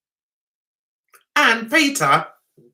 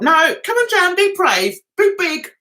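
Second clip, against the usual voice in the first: mock pep talk; 6 semitones higher than usual. Retold pep talk to herself.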